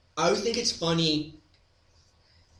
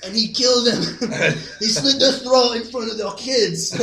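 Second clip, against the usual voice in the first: exchange student voice; 5 semitones higher, 3 dB louder than usual. Retold or imitated. Exchange student praising the uncle.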